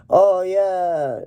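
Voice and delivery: high, drawn-out cheer; mock triumph. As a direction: stretch it out high in hammy celebration. Voices guy cheering after praise.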